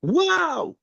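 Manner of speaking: high, awed gasp of delight; breathless, wide-eyed wow; amazed wow. Retold or imitated. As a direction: act out the women marveling at their faces. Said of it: "they go".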